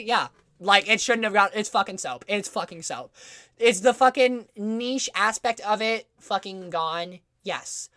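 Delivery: ordinary delivery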